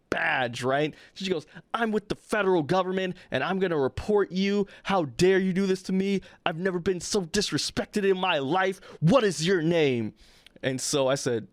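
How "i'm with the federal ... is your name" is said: a hint of a huffy government employee; imitating indignant threats. Visitor flashing her badge at the receptionist, mildly mimicked outrage. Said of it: "she gets so mad".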